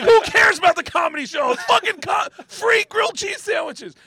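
high-pitched frenzied shouting; hammed-up crowd hysteria; excited crowd member. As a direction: yell over the room, beside yourself. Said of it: "With people going".

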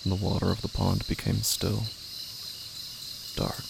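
slow, hushed, half-whispered dread; eerie horror narration. A narrator's reading. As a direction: draw the listener into the dark pond, slowly. Eerie horror story narration opening.

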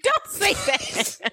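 slow, high mock protest; embarrassed protest. Real, not acted. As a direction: plead playfully, embarrassed.

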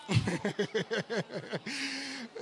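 long staccato laugh; rapid laugh. Real, not acted. Preacher laughing before praying in tongues, rapid laugh. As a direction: let out a run of rapid laughs.

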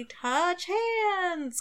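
high sing-song "touch hands", "hands" held long; a cheesy high-pitched spell call; drawn-out catchphrase. Retold or imitated. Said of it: "would use it and would say".

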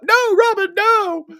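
high, loud cry of terror; panicked yell. Retold or imitated. Waking from a nightmare, he screams for Robin in panic. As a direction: shriek her name as he wakes from the nightmare. He wakes up yelling.

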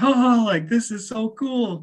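high, giddy imitation of a thrilled friend; imitated giddy thrill, loud at the start; laughing excitement. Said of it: "so he starts laughing. He's like".

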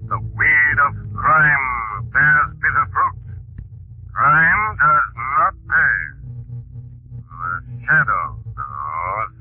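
slow, ominous, echoing narrator; ominous Shadow voice. Acted. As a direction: intone it like a sinister phantom. Shadow's signature sign-off.

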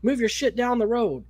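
high-pitched imitated rant. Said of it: "Just fucking berating him".

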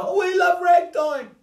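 high, slow, loud gushing fans; mock fan gush. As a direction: ham up adoring fans. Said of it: "Everybody's going".

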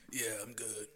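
low raspy smoker voice; smoker's voice. Retold or imitated. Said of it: "a smoker's voice".

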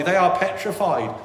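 normal talking voice

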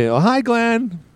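high, drawn-out singsong hello; imitated fan greeting. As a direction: sing out a gushy greeting, imitating someone. Imitates bank staff greeting him.

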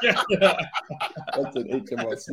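slow, laughing delivery. Real, not acted. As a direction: laugh through each letter. Laughing at tax joke.

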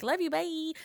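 high, soft singsong farewell; breezy mock sign-off. Telling the mailman goodbye, breezy high sign-off.

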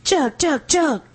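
rowdy chant urging a chug; rowdy jeering pub banter. Real, not acted. Cheering a drink being chugged.

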